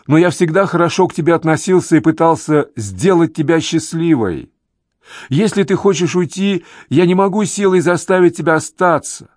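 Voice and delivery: earnest, high-pitched shouted plea; calling up to her. A narrator's reading. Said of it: "кричит он ей".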